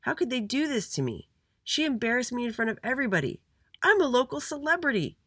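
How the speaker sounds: high-pitched self-important outrage; indignant. A narrator's reading. Little Andy rants to his family, indignant. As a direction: protest like a wronged star. Boy rants about lost badge.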